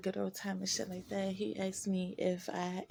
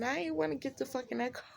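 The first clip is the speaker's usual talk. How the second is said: fast, emphatic; 5 semitones higher, faster than usual. Real, not acted. Emphatic reluctance to viewers.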